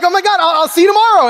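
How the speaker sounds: high, loud squealing thanks; thrilled fan. Retold or imitated. Fan thanking Larry King, high thrilled squeal. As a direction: gush like a fan who just won.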